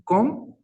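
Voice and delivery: the word "com" with its first consonant shown clearly; contrasted consonant. Shown as a demonstration. Teacher shows learners a sample word, carefully pronounced "com". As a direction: sound out "com" as a pronunciation model. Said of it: "la diferencia en la Q".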